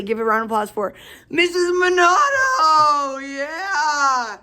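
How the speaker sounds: loud, drawn-out cheering announcement; game-show host shouting a welcome; hyped-up introduction. Pretend. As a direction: whoop up the crowd for a star guest. Hyped introduction of guest teachers.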